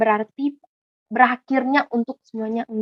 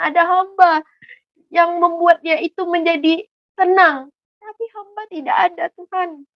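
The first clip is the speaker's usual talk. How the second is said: retold sorrowful prayer; 5 semitones higher than usual. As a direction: plead to God as a grieving mother. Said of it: "mama saya pas berdoa seperti itu, nangis, sedih". (doa ibu yang pilu, tinggi dan pelan)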